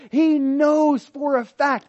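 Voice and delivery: slow, high, loud insistence; emphatic. Real, not acted. Preacher insisting to congregation, slow and emphatic. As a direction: lean hard on every word. Preacher stressing David's certainty.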